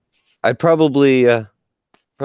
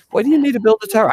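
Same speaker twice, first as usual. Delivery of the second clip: incredulous questioner; 14 semitones higher, 5 dB louder, much faster than usual. Acted. Someone asks him, fast.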